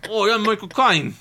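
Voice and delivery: loud, high mock-Cockney film star; Michael Caine. Cracking up a podcast co-host with a celebrity impression, hammed-up Cockney. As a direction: bellow it as an exaggerated English actor. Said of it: "We just both do Michael Caine impressions".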